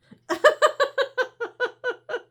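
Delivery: high, loud giggling fit; high giggle. Real, not acted. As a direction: break up laughing. Laughing fit.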